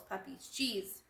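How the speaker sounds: ordinary delivery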